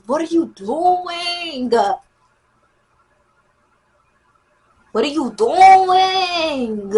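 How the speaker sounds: high, very drawn-out whine; exasperated whine. Acted. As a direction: stretch the last word into a whiny protest. Reenacts exasperated reaction, drawn out.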